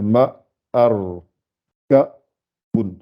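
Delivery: "ma, ar, ka, bun" with clear gaps between syllables; syllables separated. Shown as a demonstration. Teacher separating each part so learners see, segmented syllables. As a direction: sound out "ma... ar... ka... bun" one piece at a time. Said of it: "je les sépare chaque fois".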